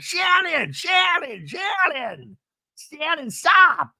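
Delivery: hammed-up pleading yells; mock calling after. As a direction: call after someone storming off. Emily calling after her.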